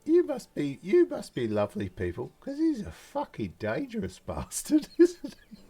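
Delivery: high, gleeful voice breaking into laughter; chummy gnome woman. Acted. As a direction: confide it high and tickled. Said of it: "she goes".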